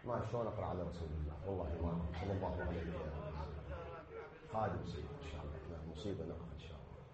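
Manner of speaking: low, very soft, fast broken words; words nearly lost to grief; overcome, murmuring quietly through tears; 1 grief-stricken. Real, not acted. Breaking into grief, fading.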